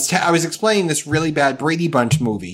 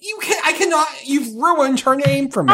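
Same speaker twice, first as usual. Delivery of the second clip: laughing protest; 10 semitones higher than usual. Real, not acted. Laughing protest about Jan.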